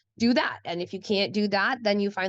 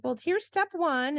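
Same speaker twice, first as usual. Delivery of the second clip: higher, bright step-by-step prompt; guiding mom; 8 semitones higher than usual. Retold or imitated. Mom walks her son through the first step, pitched higher. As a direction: lift the voice up like a cheerful tutor. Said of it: "I'd be like".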